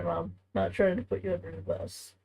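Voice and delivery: ordinary delivery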